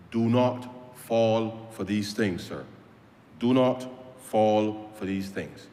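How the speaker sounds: "do not fall for these things" said slowly, NOT stressed; slow urging. Real, not acted. MP urging the public, slow deliberate warning. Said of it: "I can only urge the public".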